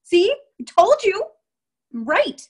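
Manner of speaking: exaggerated told-you-so; mocking gloat. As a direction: ham up a smug loser. Mocking self-defeating seller.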